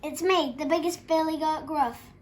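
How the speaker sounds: big, confident storybook bellow; great big bellow. A narrator's reading. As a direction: bellow boldly as the biggest goat. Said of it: "bellowed the big billy goat Gruff in his great big voice".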